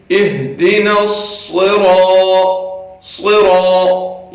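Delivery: slow صراط with thick sad and ra; heavy sad. Shown as a demonstration. Teacher modelling the heavy sad. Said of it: "صاد کو موٹا کر کے پڑھنا ہے".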